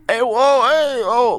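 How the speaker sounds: high caricatured Boston calls; hammed-up Boston street holler; Boston accent. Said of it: "all of these people have, like, the".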